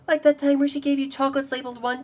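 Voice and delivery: ordinary talk, nothing marked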